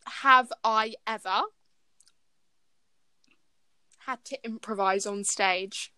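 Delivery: slow announcing game prompt; game host. Acted. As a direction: read it out like a quiz host. Reads game prompt aloud.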